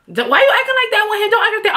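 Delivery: high-pitched, fast mock mother; scolding mom. Mother questioning daughter on the stairs, scolding. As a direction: imitate a mom butting in with a reproach. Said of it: "My mom was like".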